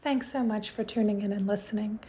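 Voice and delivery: hushed, breathy sign-off; soft outro read. Acted. Host thanking listeners in a soft whispered outro. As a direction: whisper the thanks close to the mic.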